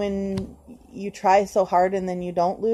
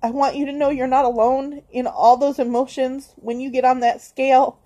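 higher and louder, sobbing through the words, slowing at the end; crying; 5 semitones higher, 4 dB louder than usual. Real, not acted. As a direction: reach out to viewers while crying. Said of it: "Why is she crying at me".

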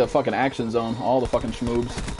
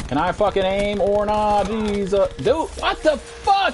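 slow, very high exasperation; high exasperated wail; 11 semitones higher, slower than usual. Real, not acted. Gamer exasperated that enemy won't die, high wail. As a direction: wail at the bullet sponge.

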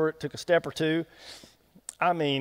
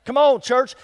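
normal talking voice

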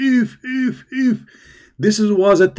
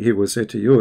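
ordinary talk, nothing marked

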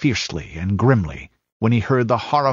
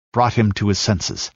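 ordinary delivery, nothing marked

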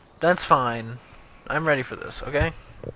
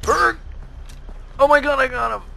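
excited shout; 10 semitones higher, 6 dB louder than usual. Real, not acted. Triumphant kill shot.

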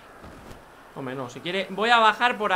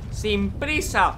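slow mock calm; 3 semitones higher, much slower than usual. Telling Juan to take his time, very slow. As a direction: stretch out sin prisa very slowly. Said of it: "a tu ritmo".

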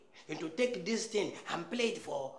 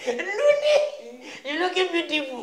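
laughing compliment; 8 semitones higher, 6 dB louder, slower than usual. Acted. Teasing compliment, high laugh.

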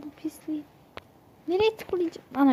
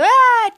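loud high mock gasp of shock; startled cry; 9 semitones higher, 13 dB louder than usual. Imitating granny's shocked look with a loud high aa. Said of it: "diye bakıyor".

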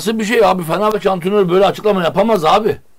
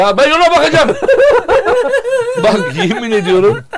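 nervous laugh; 8 semitones higher than usual. Real, not acted. Laughing at the coach's statement.

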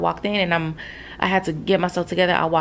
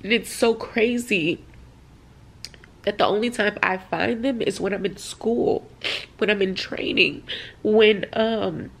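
emotional, holding back tears; 3 semitones higher than usual. Real, not acted. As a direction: push on haltingly while choked up. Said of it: "I'm so sorry. Wait a second".

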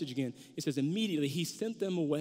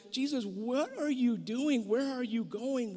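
higher, slow bewildered plea; baffled disciples; 5 semitones higher, slower than usual. Retold or imitated. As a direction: ask him what he is doing, confused.

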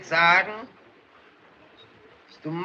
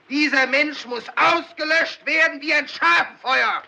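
furious demagogue shout; 6 semitones higher than usual. Acted. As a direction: whip the crowd into a verdict. Mob leader demands execution.

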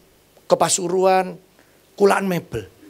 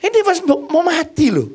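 much higher panicked cry; panicked disciples; 11 semitones higher, 3 dB louder than usual. Retold or imitated. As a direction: cry out to the sleeping teacher in panic. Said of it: "Yesus cuma gini". (murid-murid berteriak panik kepada guru, suara jauh lebih tinggi)

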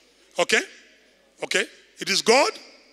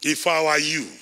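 normal talking voice

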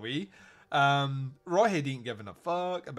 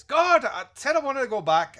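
much higher, louder exasperated outburst; fed up, insisting she leaves; annoyed; 10 semitones higher, 9 dB louder than usual. Retold or imitated. Katrina snapping at Andy.

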